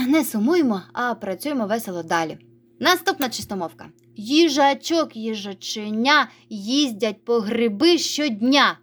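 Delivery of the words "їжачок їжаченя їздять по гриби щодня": slow, deliberate tongue-twister reading; every syllable spelled out slowly; slow and careful. Shown as a demonstration. Teacher to pupils, slow first reading. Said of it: "спочатку повільно прочитайте".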